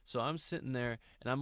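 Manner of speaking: ordinary delivery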